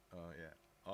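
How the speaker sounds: usual speaking voice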